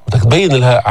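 ordinary talk

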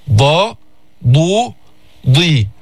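ضا ضو ضي sounded one by one; dad with vowels. Shown as a demonstration. Teacher drills ضا ضو ضي for students. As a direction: run the ض through a, u, i. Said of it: "هكذا".

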